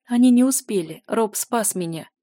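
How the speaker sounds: barely able to move the tongue; exhausted slurred speech. A narrator's reading. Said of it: "с трудом ворочая языком".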